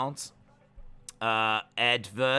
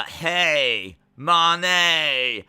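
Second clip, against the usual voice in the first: high, loud, stretched-out call; catchphrase holler; 4 semitones higher, 7 dB louder than usual. Acted. Streamer shouting a catchphrase to the chat, drawn-out holler. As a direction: holler it with long falling vowels.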